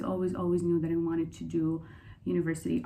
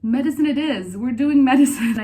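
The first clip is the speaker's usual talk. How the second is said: loud, high mock announcement; retold quick decision; 7 semitones higher, 12 dB louder than usual. Student telling family the choice, loud playful announcement. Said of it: "And I was like".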